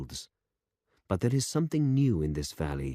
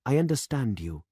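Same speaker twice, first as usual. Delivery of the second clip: put-on young demonstrator; 6 semitones higher than usual. A narrator's reading. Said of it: "said the young man".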